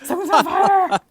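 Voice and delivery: high, loud panicked cry; alarmed shout. Retold or imitated. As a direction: yell it like there's a fire. Retold alarm about smoke.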